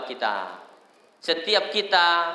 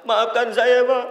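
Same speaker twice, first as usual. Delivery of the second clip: raised, louder acted plea; pleading child; 6 semitones higher, 5 dB louder, slower than usual. Child asking father's forgiveness, acted plea. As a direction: beg forgiveness in mock earnest.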